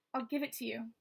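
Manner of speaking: ordinary delivery, nothing marked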